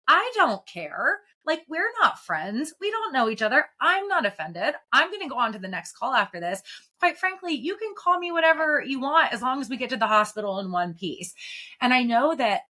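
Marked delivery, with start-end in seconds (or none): 0.1-11.3 high-pitched, emphatic dismissal; defiant retort. Acted. Provider shrugging off an insulting patient, emphatically defiant. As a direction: push the pitch up, insistently unbothered.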